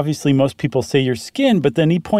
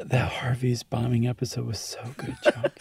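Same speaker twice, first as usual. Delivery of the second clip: playful hushed whisper, softer; mock whisper; 10 dB quieter than usual. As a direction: whisper sweet nothings to your co-host as a joke. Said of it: "I don't mind hearing a whisper".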